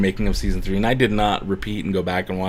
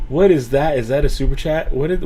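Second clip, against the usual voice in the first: ordinary talk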